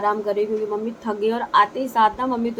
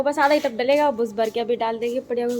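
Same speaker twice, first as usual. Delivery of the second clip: ordinary talk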